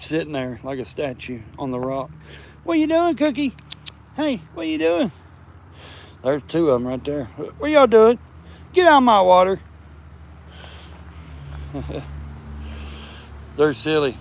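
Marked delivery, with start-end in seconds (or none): 2.6-5.1 high sing-song voice to the cats; cat-calling voice. Real, not acted. Man calling to stray cats in a high voice. 7.6-9.6 high, loud teasing call; loud playful call. Real, not acted. Man shouting playfully at cats by the water. 9.6-13.5 breathy silent laugh; quiet wheezing laugh. Real, not acted. Man laughing at the silly cats.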